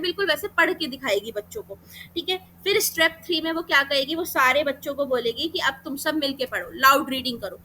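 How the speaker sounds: ordinary talk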